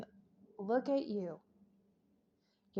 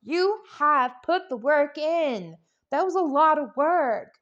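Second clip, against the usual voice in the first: spirit praising loudly; 5 semitones higher, 7 dB louder than usual. Retold or imitated. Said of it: "Spirit is looking at you going".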